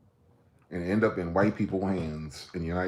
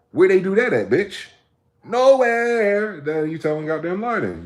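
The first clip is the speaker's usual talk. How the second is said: heated challenge; 9 semitones higher, 5 dB louder than usual. Real, not acted. Challenging the listener.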